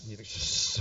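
repeated soft, voiceless shh; hushed shushing. Retold or imitated. Preacher acting out his answer about numbers, soft shushing. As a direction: let out soft hisses of air. Imitated hissing sound in retold scene.

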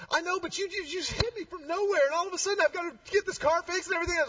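high, fast complaining woman; upset woman =132. Retold or imitated. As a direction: whine it high and quick. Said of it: "She goes".

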